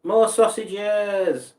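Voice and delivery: high, loud and very slow; mock shout. Scene: reading a viewer's chat line, a loud drawn-out call.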